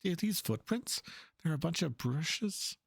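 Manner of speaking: searching hero voice. Acted. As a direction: look around for him, curious and a bit worried. Voices searching game character.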